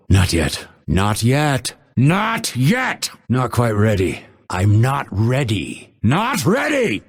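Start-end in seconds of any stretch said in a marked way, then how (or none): 2.0-3.1 high, exasperated cry; impatient refusal. Acted. Fighter refusing a request, impatient cry. 6.0-7.0 high-pitched snap; exasperated refusal. Acted. Fighter refusing a request, exasperated cry.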